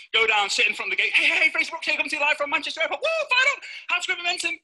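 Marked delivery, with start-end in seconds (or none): 1.1-4.6 high, rapid-fire live-video opener; over-the-top fired-up host; hyped livestream greeting. Acted. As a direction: launch the broadcast high and bursting with energy. Acts out starting airport Facebook Live.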